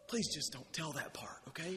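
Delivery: hushed pleading whisper; whispered plea. Acted. Peter whispering to the disciples to hide the story. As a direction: whisper it like a secret begging them to keep quiet. Peter begging, voiced as secret.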